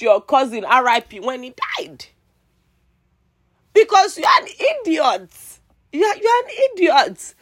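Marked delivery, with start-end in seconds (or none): 3.7-7.3 pitched-up furious insult; scornful high-pitched outburst; angry high yell. Real, not acted. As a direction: spit it out high and hard. Insulting the laughing bullies.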